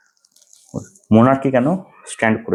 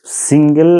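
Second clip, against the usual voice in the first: Single said higher and louder, rising; stress on single; 5 semitones higher, 5 dB louder, slower than usual. Shown as a demonstration. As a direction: lecturer punching the word single. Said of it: "single order system ছিল না". (Single শব্দে জোর দিয়ে)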